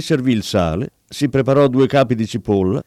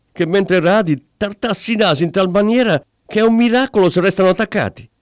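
much higher, furious wife yelling at her husband; angry shout; 8 semitones higher than usual. Acted. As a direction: scream it at him in outrage. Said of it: "gridò con ira". (moglie furiosa che grida al marito)

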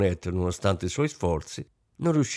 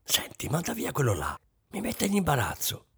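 hushed, urgent whisper to her husband; 6 semitones higher, 6 dB quieter than usual. A narrator's reading. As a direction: lean in and breathe it so the servant won't hear. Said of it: "Rosine bisbigliò". (sussurrato con urgenza al marito)

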